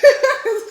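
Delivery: short, loud, high burst of laughter; sudden laugh. Real, not acted. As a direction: burst out laughing mid-story. Amused by the ginger question.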